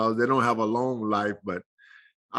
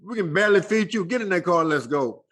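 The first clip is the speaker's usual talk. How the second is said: mom's voice pitched much higher, fast on "feed you"; scolding mom; 8 semitones higher than usual. Retold or imitated. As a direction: play a mother shooing her boy off, higher voice. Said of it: "She said".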